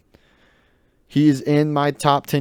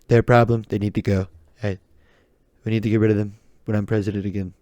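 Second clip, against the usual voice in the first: lightly voiced Trump, fast and low; a hint of Trump rallying the crowd; quick mock Trump campaign line; Donald Trump impression; 6 semitones lower, faster than usual. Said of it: "doing the Don".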